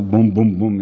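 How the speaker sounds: ordinary talk, nothing marked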